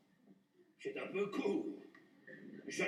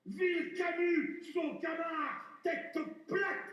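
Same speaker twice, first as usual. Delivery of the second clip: furious shout; 6 semitones higher, much slower than usual. Acted. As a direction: bellow the insult with wounded pride. Cyrano's furious outburst.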